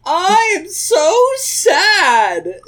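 high, drawn-out mock wail; overdone tearful whine, very high; hammed-up crying. A narrator's reading. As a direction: wail it slowly like a badly faked sob. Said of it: "said Amy in a crying way".

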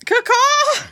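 high, loud double caw; crow caw. Acted. Bird call in play.

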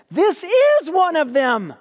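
high, slow pointing-out accusation; a tattletale girl telling the bystanders, high and deliberate; accusing servant girl. Acted.

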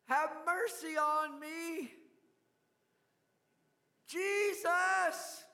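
high, loud desperate cry for help; desperate shout. Acted. Blind beggar shouting to Jesus for mercy, loud desperate cry. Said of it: "he began to shout".

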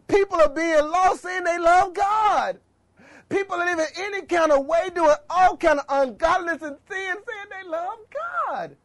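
high, urgent preaching; impassioned outcry. Real, not acted. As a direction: cry it out to the congregation. Impassioned lament over sinners.

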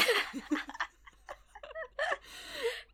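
high-pitched burst of real laughter; laughing. Real, not acted. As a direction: crack up helplessly after your own impression. Cracks up after own impression.

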